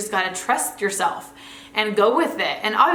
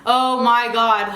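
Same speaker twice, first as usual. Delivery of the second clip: slow, drawn-out and a little louder, fed up; irritated groan; 2 semitones higher, 4 dB louder, slower than usual. Retold or imitated. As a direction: let the irritation drag out each word. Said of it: "I'm just like".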